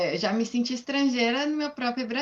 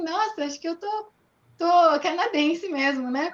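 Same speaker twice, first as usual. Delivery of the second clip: amused realisation; 7 semitones higher, 7 dB louder than usual. Retold or imitated. Retold happy realisation in rain.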